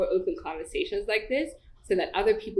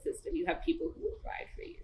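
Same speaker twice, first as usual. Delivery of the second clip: choked-up near-whisper, much softer; emotional whisper; 10 semitones higher, 9 dB quieter, faster than usual. Real, not acted. Guest to host on support, emotional whisper. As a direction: overcome with feeling, voice going to breath. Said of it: "I'm getting emotional".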